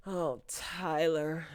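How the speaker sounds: slow, weary "Oh, Tyler"; exasperated sigh. Real, not acted. Sighing at the show's writer, slow and fed up.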